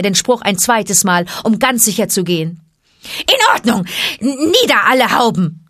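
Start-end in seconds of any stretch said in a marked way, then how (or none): 3.2-5.6 high, loud hissing chorus; hissing snakes. A narrator's reading. Cobras answer Mowgli, hissing and high. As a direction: hiss it like many cobras. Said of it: "zischte ein halbes Dutzend Stimmen".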